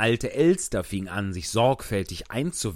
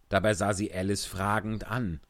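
usual speaking voice

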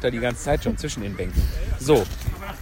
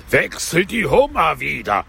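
raised, wailing Gryphon cry; howling Gryphon; 5 semitones higher than usual. A narrator's reading. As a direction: howl it higher, swooping. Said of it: "heulte der Greif mit erhobener". (heulend mit erhobener Stimme)

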